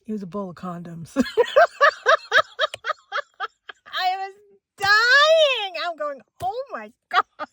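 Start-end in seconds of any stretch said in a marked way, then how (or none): 1.2-3.5 loud, high cackling laugh; uproarious laugh. Real, not acted. Cracking up at the bowl reveal. 3.9-7.5 high, hysterical near-shriek; dying of laughter. Real, not acted. Hysterical retelling of mortifying mistake.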